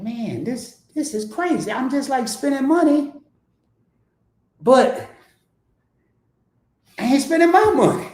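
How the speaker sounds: high, building disbelief; rising giddy amazement; amazed self-quote. Retold or imitated. Telling viewers about spending free money, high and giddy. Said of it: "So I'm like".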